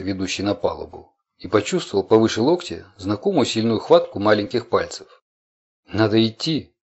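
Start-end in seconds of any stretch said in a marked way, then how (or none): none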